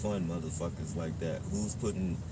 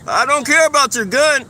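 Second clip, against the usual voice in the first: loud, insistent correction; loud call-out; 16 dB louder than usual. Real, not acted. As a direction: shout it back. Arguing with a stranger.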